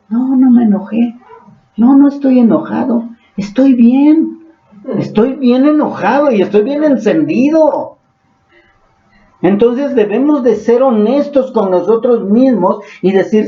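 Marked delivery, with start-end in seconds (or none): 0.1-8.0 mock angry "no estoy enojado", raised higher; acting out a heated denial of anger; imitating angry denial. Acted angry denial, scolding. (negando el enojo con enojo fingido)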